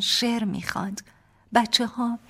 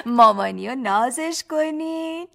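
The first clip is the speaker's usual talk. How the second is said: put-on higher, louder cooing to the kids; baby talk; 6 semitones higher, 8 dB louder than usual. Father urging the children to kiss mom, baby talk. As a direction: coax the toddlers sweetly.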